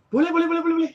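high, loud eager yeses; eager agreeing. Retold or imitated. Bank manager eagerly agreeing with the customer. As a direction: gush yes over and over to please her.

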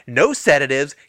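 hammed-up frantic girlfriend, pitched high; crazy ex-girlfriend. Imitating a crazy ex-girlfriend giving orders, in a high mock yell. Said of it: "like a crazy ex-girlfriend".

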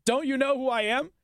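mocking the mayor's who-do-you-think-I-am tone; mock entitled mayor. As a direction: whine it like an entitled bigshot. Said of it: "That's what she just pulled".

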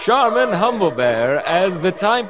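slow grand trailer read; drawn-out trailer narrator; announcer voice. Acted. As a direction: roll out each name like a movie credit. Announcer lists the cast.

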